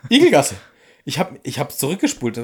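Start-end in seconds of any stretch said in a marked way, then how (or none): 0.1-0.5 high, loud parroting Igelgasse, Igelgasse; mocking Harry's call. Mocking how Harry says it in German. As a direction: repeat it like a puzzled child. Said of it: "im Deutschen sagt er".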